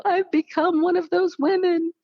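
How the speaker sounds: high, crying wail; tearful wail to her sister. Retold or imitated. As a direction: break down as you say it. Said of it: "I was crying".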